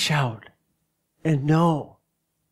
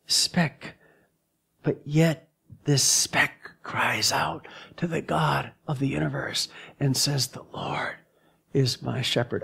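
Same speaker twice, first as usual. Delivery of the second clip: softer, breathy whisper of a tiny creature; small voice whispering a prayer; awed whisper; 4 dB quieter than usual. Acted. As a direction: whisper it like a speck crying to God. The tiny speck cries out.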